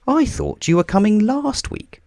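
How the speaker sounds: Mister Bump's voice. A narrator's reading. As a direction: pitch him up as Mister Bump. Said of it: "said Mister Bump".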